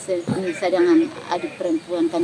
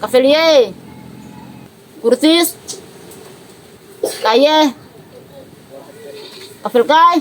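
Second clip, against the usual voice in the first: calling out; slower than usual. Real, not acted. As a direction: call out to a relative far away. Calls out to absent Kurtis.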